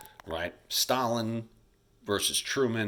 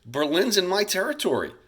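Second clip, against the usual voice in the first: higher, louder mocking taunt; teacher hamming up a smug Stalin; 9 semitones higher, 4 dB louder than usual. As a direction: play Stalin gloating over Berlin. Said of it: "So what Stalin decides is".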